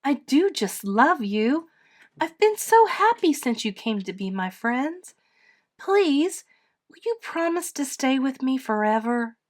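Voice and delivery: put-on high, earnest child's plea; high-pitched affectionate outpouring; gushing little girl. A narrator's reading. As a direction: plead lovingly like a child. Edith pleads, hugging Mister Bear.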